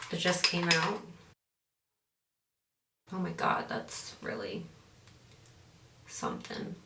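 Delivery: normal talking voice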